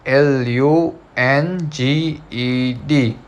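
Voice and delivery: slow, letter by letter spelling. Shown as a demonstration. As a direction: spell each letter slowly. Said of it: "Number third is".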